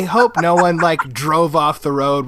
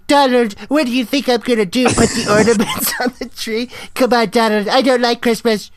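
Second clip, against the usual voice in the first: higher, put-on voice of a wife complaining; Donald doing Melania; 6 semitones higher than usual. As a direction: Trump hamming up his wife's voice. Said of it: "your impression of Melania was Donald doing Melania".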